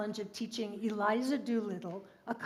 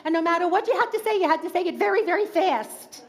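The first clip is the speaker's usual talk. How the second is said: put-on Queens accent, rattled off at top speed and higher; fast, higher Queens accent, words tumbling out; a mock Queens accent, pitched up and rushed; rapid Queens accent; 6 semitones higher, 4 dB louder, much faster than usual. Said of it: "surrounded by peers who talked in a Queens accent".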